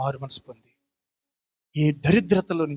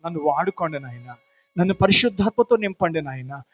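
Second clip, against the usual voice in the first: loud, higher pleading prayer, building to a near shout; loud fervent prayer; 4 semitones higher, 5 dB louder than usual. Acted. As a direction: cry out to God, begging to be changed. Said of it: "అందరూ నోరు తెరిచి".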